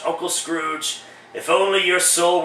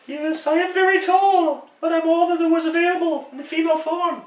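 much higher, airy woman's voice for the ghost; falsetto female ghost; 11 semitones higher than usual. Acted. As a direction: play the spirit as a tall lady. Ghost of Christmas past, female.

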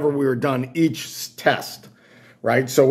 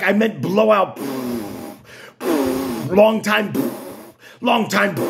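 impatient technician; 4 semitones higher than usual. Retold or imitated. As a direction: correct him sternly, dragging out long. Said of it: "She's like".

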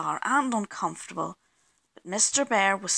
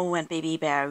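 ordinary delivery, nothing marked

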